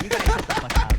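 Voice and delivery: high screamed shout, far above normal; shrieking shut up; mock yell. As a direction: yell it like a furious ally. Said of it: "being like".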